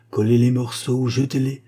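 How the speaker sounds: plain reading voice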